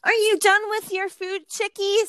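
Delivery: very high sing-song kiddie talk; babying aunt impression. Aunt asking older kids as if they were small, high babying voice. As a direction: mimic an aunt talking down to toddlers, high-pitched.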